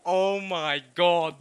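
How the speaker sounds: loud, drawn-out groan of recognition; overwhelmed exclamation. Real, not acted. Reader floored by a relatable line, loud drawn-out exclamation. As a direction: stretch it out loud and slow.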